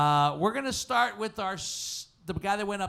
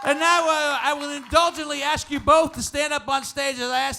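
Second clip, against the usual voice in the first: shouting over applause; 8 semitones higher, 9 dB louder than usual. Real, not acted. Host readies audience for final vote, shouted announcement. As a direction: project over the crowd and build to the vote.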